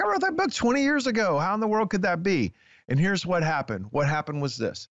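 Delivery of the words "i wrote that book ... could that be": high-pitched retold bewilderment; incredulous. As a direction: act out being baffled by unbelievable news. Acts out disbelief at publisher's news.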